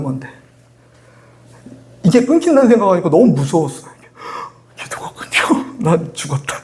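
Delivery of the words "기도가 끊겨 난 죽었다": slow, breathy whisper of mock dread; hammed-up frightened whisper; mock panicked whisper. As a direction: whisper it as if doomed. Retells his own panic.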